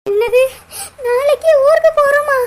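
loud, high, startled cry of disbelief; startled shout. Acted. Told she leaves tomorrow, a loud shocked cry. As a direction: blurt it out in shock.

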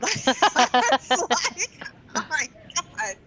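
high, slow words through laughter; laughing hard. Real, not acted. Remembering the monotone game, laughing through the words.

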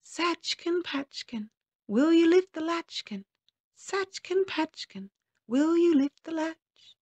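high chanting magic man; high, bell-like sing-song rhyme; thin, clear little voice. A narrator's reading. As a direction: ring it out like a silver bell. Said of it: "thin and clear like the sound of a silver bell".

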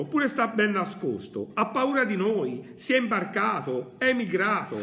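high, loud sneering jokes; mocking bystander. Crowd members keep mocking the madman, high and loud. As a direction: pile on the ridicule, laughing at him. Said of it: "fece un altro".

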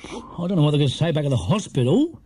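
hesitant, doubtful ambulance man. A narrator's reading. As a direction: voice your doubts about the patient. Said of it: "he said doubtfully".

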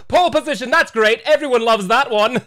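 high, loud British scorn; gleefully mocking British voice; British accent. Nintendo sneering at pole position, high British accent. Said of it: "Nintendo has a British accent".

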